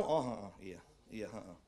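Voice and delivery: low, soft, absent-minded mumbling; half-listening with a low murmur of yeses; bored partner barely paying attention; distracted girlfriend. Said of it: "Si pacar kamu".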